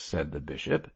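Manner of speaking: plain reading voice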